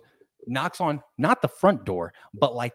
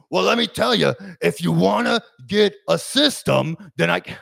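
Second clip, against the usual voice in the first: loud, raised salesman's phone voice; 6 semitones higher, 9 dB louder than usual. Retold or imitated. Salesman on a bathroom phone call, loud. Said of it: "like, loud".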